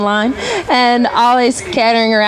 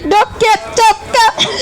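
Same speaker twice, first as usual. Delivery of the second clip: high, strangled mock panic; squeaky stammered alarm, breaking into laughter; choked warning; 12 semitones higher, much slower than usual. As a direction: imitate a warning that won't come out. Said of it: "couldn't even get it out of my mouth".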